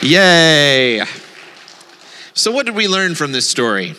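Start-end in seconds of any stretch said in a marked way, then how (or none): 0.0-1.0 loud, long drawn-out yay; cheer. Pretend. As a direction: cheer the ending in one stretched note. Cheers at the end.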